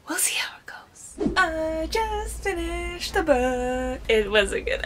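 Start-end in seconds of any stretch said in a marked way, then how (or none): 0.1-1.3 whisper. Real, not acted. Whispered aside. 1.4-4.0 excited. Real, not acted. Sudden news after finishing.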